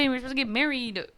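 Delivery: ordinary talk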